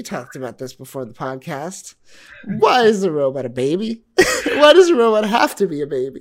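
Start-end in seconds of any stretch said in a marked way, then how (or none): 2.4-6.2 loud, fast laughing question; outraged disbelief. Real, not acted. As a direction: demand an answer half laughing. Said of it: "laughing outraged question".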